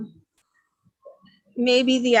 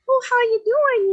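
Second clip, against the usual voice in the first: much higher, chirpy mock Minnesota accent; imitating Minnesota ladies; 13 semitones higher, 5 dB louder than usual. Retelling ladies chatting in Minneapolis, in a high mock Minnesota accent. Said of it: "I heard the accent from Fargo".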